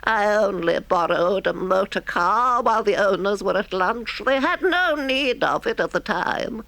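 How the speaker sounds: high-pitched, defensive excuse; guilty toad making light of it; high, wheedling self-justification; embarrassed Toad. A narrator's reading. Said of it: "colouring deeply".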